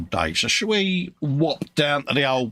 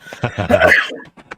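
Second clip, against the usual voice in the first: burst of laughter; 3 semitones lower, 7 dB louder than usual. Real, not acted. Laughs at the teasing.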